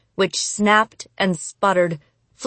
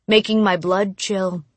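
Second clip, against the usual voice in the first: plain reading voice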